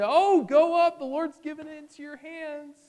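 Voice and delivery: very high singsong encouragement, loud start then easing off; sycophantic cheering. Acted. False prophets egging on their king, fawning. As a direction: cheer him on like fawning yes-men. King's yes-men all cheer him on.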